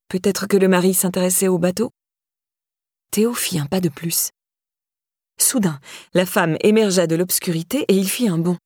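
ordinary delivery

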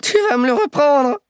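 put-on high, pleading voice of a terrified old crook; panicked old man. Terrified old thief begging the boy not to kill him, high panic. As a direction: beg like you're sure he'll shoot.

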